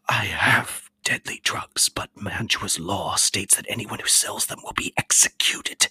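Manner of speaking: put-on thin whispered voice of a scared old man; frail apothecary whispering; fearful apothecary. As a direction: whisper like a wretched recluse.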